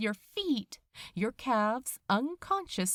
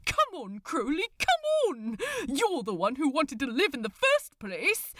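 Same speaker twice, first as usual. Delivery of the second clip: high, loud pleading shout; exasperated exclamation; 5 semitones higher, 3 dB louder than usual. Acted. Aziraphale begging Crowley to move, loud exasperated shout. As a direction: shout him out of his daze in disbelief. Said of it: "he exclaimed in disbelief".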